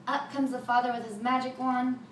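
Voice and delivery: ordinary delivery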